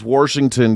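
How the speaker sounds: "Washington" said as "Warshington"; Nebraska pronunciation. Retold or imitated. Comedian showing how they say it, Nebraska pronunciation. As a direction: slip an R before the "sh" in "Washington". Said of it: "That's how they say Washington in Nebraska".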